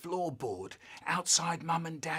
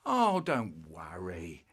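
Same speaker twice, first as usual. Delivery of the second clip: reassuring mum; 9 semitones lower, slower than usual. Retold or imitated. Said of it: "Mum comes in and says".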